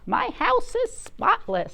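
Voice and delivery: high, sing-song housewife voice; farmer's wife. Acted. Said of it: "said the farm-, says the farmer's wife".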